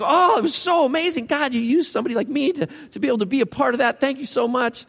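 elated post-camp gratitude; mock elated gushing. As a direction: gush like you just saw a miracle. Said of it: "you're like".

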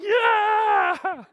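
high wild mock cheer; hammed-up teenage roar; imitated crowd cheer. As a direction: cheer like screaming high schoolers. Mimics cheering high school crowd.

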